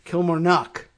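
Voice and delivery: "Kilmarnock" stressed on "Kil" and "nock"; stress first and last. Shown as a demonstration. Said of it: "emphasis on the first and last syllables".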